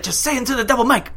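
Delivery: high-pitched, quick imitation; urgent mimicked demand; excited interjection. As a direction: fire it off high and fast. Acting out McGregor at press conference.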